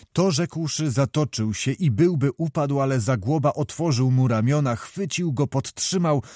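usual speaking voice

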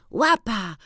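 a high, glad call to the dog; joyful cry. A narrator's reading. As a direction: call the dog's name, delighted. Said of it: "wykrzykiwał".

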